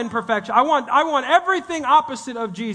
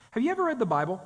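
ordinary delivery

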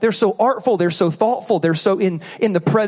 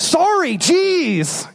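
high, exasperated sorry, jeez; irritated sorry; 9 semitones higher, much slower than usual. Shown as a demonstration. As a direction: snap it out in the heat of the moment. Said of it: "in the moment, you're like, mad".

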